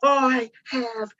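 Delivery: slow, scandalized huff; appalled grandma. Retold or imitated. Mother-in-law appalled at the toddler, slow and scandalized. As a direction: clutch the pearls.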